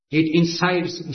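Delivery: ordinary delivery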